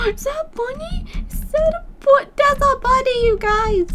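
very high, loud squeal; delighted squeak to viewers; squealing excitement. Real, not acted. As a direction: gush over a cute bunny. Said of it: "my voice gets so high when I get excited".

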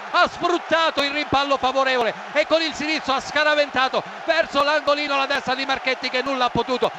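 ordinary talk, nothing marked

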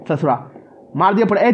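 ordinary talk, nothing marked

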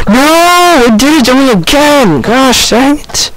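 high-pitched frustrated wail; exasperated outcry. Real, not acted. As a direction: cry out at the game's betrayal. Same game mishap happens again.

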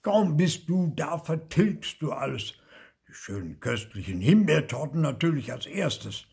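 outraged uncle laying blame; indignant scolding. A narrator's reading. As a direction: scold your nephew with full emphasis. Uncle grabs and scolds nephew.

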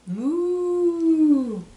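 long, loud, high moo; cow mooing. Retold or imitated. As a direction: draw out a big cow moo. Voices the cow in picture book.

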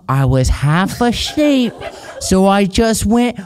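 slow, mock British baby talk; baby Brit accent. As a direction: read it in a silly, drawn-out British accent. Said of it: "Yours is like a baby Brit".

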